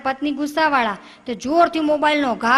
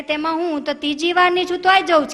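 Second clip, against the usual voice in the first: laughing call back; 5 semitones higher, 5 dB louder than usual. Retold or imitated. First woman calls back laughing.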